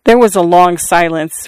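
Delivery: ordinary delivery, nothing marked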